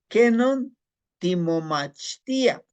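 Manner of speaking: "timomachtia" drawn out slowly after a high loud "¿Qué non"; slow Nahuatl demo. Shown as a demonstration. Teacher modeling "¿Qué non timomachtia?" for students. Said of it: "teaching Nahuatl phrase".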